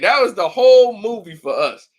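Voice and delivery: normal talking voice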